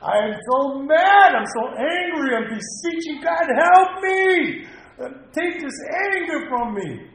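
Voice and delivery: loud, high cry of anger, begging God; desperate scream. Retold or imitated. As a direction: yell out the anger and plead for help. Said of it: "I scream sometimes".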